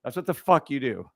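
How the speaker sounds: ordinary talk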